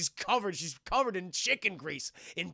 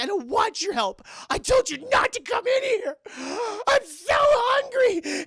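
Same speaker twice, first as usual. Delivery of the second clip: very high, loud mock shriek; hammed-up wailing yell; hysterical outburst; 9 dB louder, slower than usual. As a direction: scream it, tearful and frenzied. Girl snaps at helper, frenzied hunger.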